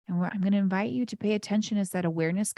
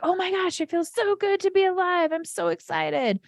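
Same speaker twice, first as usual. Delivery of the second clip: high-pitched, gushing mock excitement; joyous; 11 semitones higher, 4 dB louder than usual. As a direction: squeal it like a body part thrilled to be alive. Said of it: "there might be places that feel joyous".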